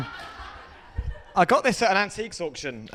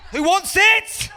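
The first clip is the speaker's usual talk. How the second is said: high, louder laughing challenge; louder cocky shout; 14 semitones higher, 7 dB louder, slower than usual. Acted. Karate mate in a street fight, louder high taunting shout. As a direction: taunt the whole street even harder.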